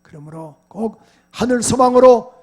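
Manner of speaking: loud, rising call to the church; fiery preaching. Acted. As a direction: urge the congregation with full voice. Preaching at full force. (회중에게 힘차게 외치는 설교)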